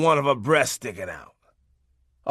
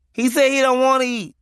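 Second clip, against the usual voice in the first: put-on high, louder kid voice relaying the message; child messenger; 10 semitones higher, 5 dB louder than usual. Little kid relays daddy's refusal to mom, high child voice. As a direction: pass it on like a small child would.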